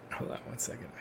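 whispered aside. Real, not acted. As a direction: whisper it off-mic. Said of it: "whispered aside".

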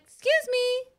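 high-pitched "excuse me"; retold polite call. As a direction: pipe up high to get past someone. Acting out her polite request to pass.

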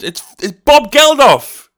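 frustrated yell. Retold or imitated. Yelling the answer at a dim game show contestant. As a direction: spell it out in outrage. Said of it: "He's like".

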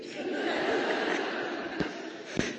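quiet airy laugh at the punchline; hushed laughter; soft breathy laugh. Real, not acted. Laughs at daughter's joke.

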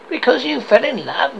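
high, incredulous echoed question; growled disbelief. Acted. Scrooge repeats his nephew's reason, scornful disbelief. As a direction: throw it back as a scornful question. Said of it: "growled Scrooge".

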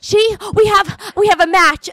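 high, loud breathless announcement; mock out of breath. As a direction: blurt it haltingly like a winded mother. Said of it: "you were like, out of breath".

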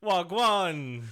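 put-on high, loud Jamaican-accented greeting; self-admittedly poor Jamaican accent. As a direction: belt out a bad Jamaican greeting. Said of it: "Mine's not very good".

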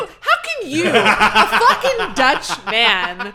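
loud, high, laughing mock fury; hammed-up outrage, laughing through it; mock outraged rant. Mock outraged rant at Dutch friend.

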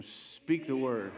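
drawn-out, rallying call; with some enthusiasm. Shown as a demonstration. Preacher getting the congregation to repeat the words, enthusiastic. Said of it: "say it with some enthusiasm".

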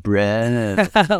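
lightly put-on surfer drawl on yeah; a hint of a laid-back California surfer; surfer dude. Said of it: "not everyone in California is like".

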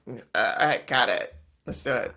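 put-on loud, sloppy drunk voice. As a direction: slur it like you're tipsy. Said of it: "That's her drunk voice".

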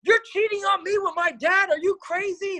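high, loud mock outrage; shrieking accusation, hammed-up; acted screaming. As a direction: scream it like a betrayed boyfriend. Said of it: "screaming like a crazy person".

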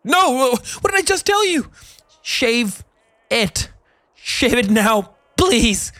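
high, frantic, slowed-down plea; begging frantically, near breaking; desperate pleading. A narrator's reading. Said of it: "The man's voice returns to normal".

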